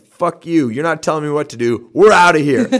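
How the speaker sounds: hammed-up brash rebel yelling at the British; defiant mock American. Acting out Americans telling off British.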